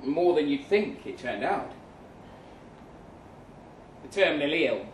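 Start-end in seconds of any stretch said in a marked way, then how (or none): none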